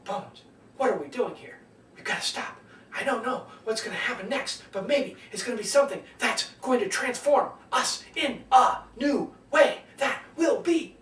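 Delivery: hushed urgent voice with deliberate pauses; William Shatner as Captain Kirk. Retold or imitated. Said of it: "William Shatner and his character of Captain Kirk".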